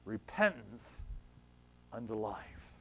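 soft, slowing close in reverence; hushed reverence. Real, not acted. Preacher ending the reading to church, hushed and reverent. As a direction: let the final words fall away softly.

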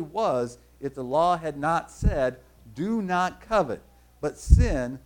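slow, emphatic scripture reading. Real, not acted. Preacher reading Romans to the congregation, slowly and deliberately. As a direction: read each phrase out slowly, weighing key words. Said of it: "I'll try to read it slowly".

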